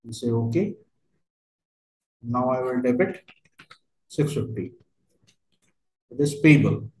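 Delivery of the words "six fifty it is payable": normal talking voice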